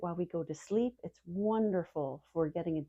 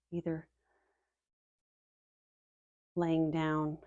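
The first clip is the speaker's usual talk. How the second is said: guided meditation; 3 semitones lower than usual. Acted. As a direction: ease listeners calmly into the body, letting phrases settle. Leads a body-scan meditation.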